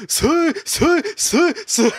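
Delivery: string of high-pitched hoots; repeated mock yell. Imitating an excited racer calling out repeatedly, high mock yell. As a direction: whoop it over and over, horn-like. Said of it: "multiple times in a row".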